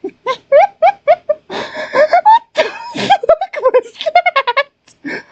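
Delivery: a real, helpless high-pitched laughing shriek. Real, not acted. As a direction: squeal it out, high and laughing in disbelief. Laugh fit at NPC voice.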